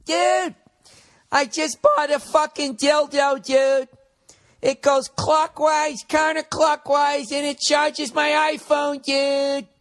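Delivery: very high excited shouting bro voice, slow and drawn out; Bobby Kelly impression. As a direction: squeal it like a thrilled gadget bro, stretching each word. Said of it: "it always ends with".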